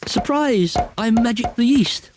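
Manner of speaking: high, gleeful announcement; excited cry. Acted. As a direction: shout it up high, proud of the trick.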